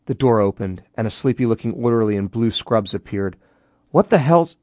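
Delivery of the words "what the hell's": high, loud startled outburst; raised voice, cut off mid-question; startled, trailing off. A narrator's reading. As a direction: break off in shock. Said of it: "He trailed off as he noticed the corpse".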